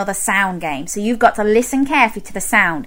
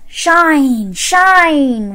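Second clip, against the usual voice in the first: higher, gliding sing-out; the shining sun; 4 semitones higher, 3 dB louder than usual. Acted. Storyteller being the sun at the window, higher gliding call. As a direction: let each word beam out and fall. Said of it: "went the sun".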